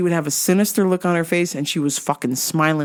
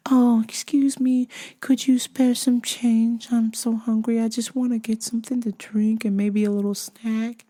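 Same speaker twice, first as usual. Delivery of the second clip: pretending best behavior; 4 semitones higher, 3 dB quieter than usual. Homeless woman asks for spare change, put-on politeness. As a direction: plead sweetly and a little higher, drawing it out. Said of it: "pretending to be, you know, on her best behavior".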